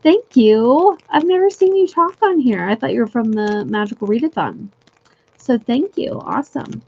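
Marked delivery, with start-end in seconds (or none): none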